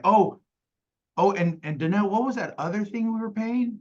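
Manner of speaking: friendly rapport. Shown as a demonstration. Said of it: "when I'm building rapport".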